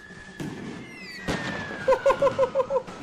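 long high-pitched giggle; real delighted high titter. Real, not acted. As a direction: let a squeaky giggle out. Giggles at kill.

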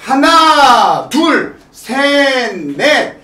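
loud, slow count with stretched beats; dance count. Shown as a demonstration. Teacher showing how the routine starts, loud drawn-out count. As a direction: punch out each beat like an instructor.

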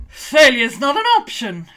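hammed-up serious pronouncement; mock stern. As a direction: announce it like a grim NASA commander. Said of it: "their immediate reaction to that is".

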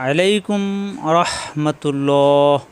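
very slow, stretched Arabic salutation; drawn-out formal greeting. Real, not acted. Teacher greets the class, slow formal salutation. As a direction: draw out each syllable in greeting.

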